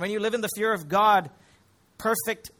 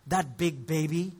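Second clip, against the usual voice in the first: shaky voice; 5 semitones lower than usual. A narrator's reading. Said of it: "broken by this shaky voice".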